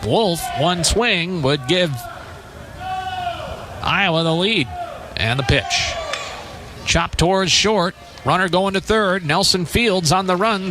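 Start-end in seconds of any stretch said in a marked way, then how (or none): none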